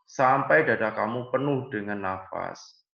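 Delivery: ordinary talk, nothing marked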